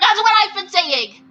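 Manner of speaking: high, triumphant exclamation; finally someone agrees, crow it; vindicated outburst. Real, not acted. Reader hears a character agree with them, high and triumphant.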